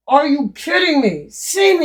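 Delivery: incredulous at the price; surprised. Real, not acted. To viewers about the Amazon price, incredulous.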